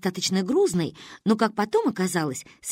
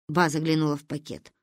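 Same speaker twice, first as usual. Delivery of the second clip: usual speaking voice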